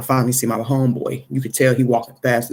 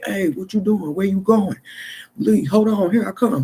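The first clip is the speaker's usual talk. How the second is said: higher, calling-after friend; homeboy's voice; 8 semitones higher than usual. Retold or imitated. Friend who never called him back, higher hypothetical call. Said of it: "He didn't say".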